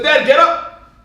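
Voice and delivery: loud, high, abrupt order; rude demand. Acted. Esau rouses his father, a rude loud demand. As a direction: order him up with no courtesy at all. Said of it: "As opposed to Esau".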